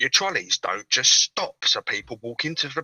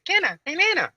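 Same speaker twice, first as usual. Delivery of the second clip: high-pitched mocking imitation; mimicking her. Caller retells her copying him, high mocking imitation. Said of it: "She went".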